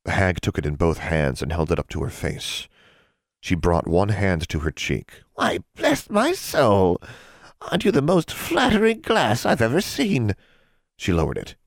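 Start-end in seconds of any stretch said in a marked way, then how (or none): 5.3-10.4 high, slow, gushing hag voice; delighted gush. A narrator's reading. Hag admiring a flattering mirror, gushing. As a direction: swoon over the flattery.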